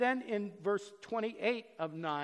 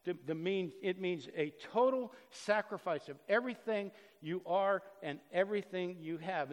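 normal talking voice

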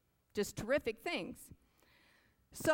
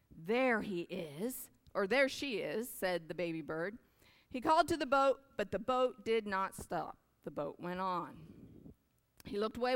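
storybook reading; 3 semitones higher than usual. A narrator's reading. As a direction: read it like a bedtime picture book. Reads picture book aloud.

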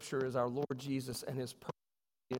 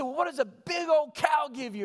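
much higher, louder excited call; teacher's big question; 13 semitones higher, 7 dB louder than usual. Retold or imitated. Teacher asking the class, loud excited call. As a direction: build it up like the big finale question. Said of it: "She said".

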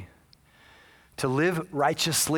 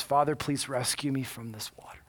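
low, half-whispered plea to God; quiet retold desperate prayer; hushed prayer; 4 semitones lower, 5 dB quieter than usual. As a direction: pray softly, almost voiceless. Caller prays as water rises.

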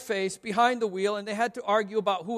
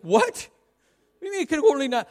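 incredulous; 5 semitones higher, 6 dB louder than usual. Retold or imitated. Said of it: "It's like".

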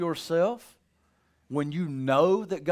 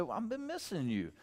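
soft, gentle welcome back; tender greeting; 9 dB quieter than usual. Shown as a demonstration. Pastor greets an absent member, soft and tender. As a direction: say it softly, with love. Preacher voices loving greeting.

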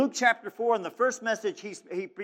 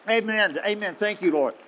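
ordinary delivery

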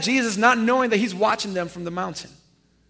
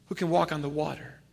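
hushed reveal; 5 semitones lower, 8 dB quieter than usual. Real, not acted. Dramatic drop on key line.

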